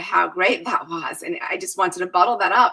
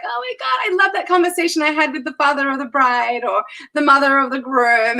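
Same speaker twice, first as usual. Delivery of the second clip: much higher, gushing over the memory; gushing excitement; 6 semitones higher than usual. Retold or imitated. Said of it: "I'm always like".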